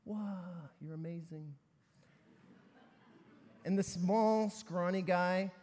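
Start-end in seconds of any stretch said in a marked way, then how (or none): 0.1-1.5 hushed awe. Acted. Onlookers marvel at the big guy.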